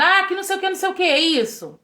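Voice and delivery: hammed-up annoyed retort to her husband; irritated snap. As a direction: replay her own impatient snap at her husband. Retelling snapping at husband when stressed.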